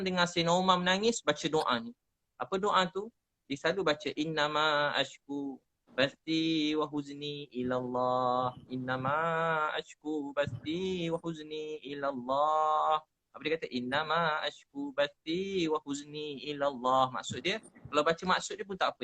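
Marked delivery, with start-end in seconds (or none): 4.2-13.0 drawn-out devotional recitation; measured, reverent Arabic chant; slow Quranic recitation. Shown as a demonstration. 13.7-17.1 drawn-out devotional recitation; slow Quranic recitation. Shown as a demonstration. Recites verse again.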